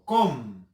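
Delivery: "Com" alone, firmly and falling; single emphatic "Com"; stressed syllable isolated. Shown as a demonstration. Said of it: "identificamos la sílaba tónica".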